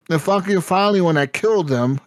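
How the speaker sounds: ordinary delivery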